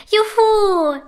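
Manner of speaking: high, loud whoop of delight; joyful cheer. A narrator's reading. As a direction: cheer like a happy child. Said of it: "jubeln die beiden Prinzessinnen".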